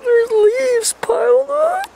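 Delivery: fake-cry it in a high, slow, panicked wail; mock terrified crying. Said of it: "I'm really fucking scared right now".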